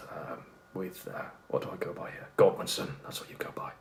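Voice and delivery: whispered aside. Real, not acted. Whispered aside about a name.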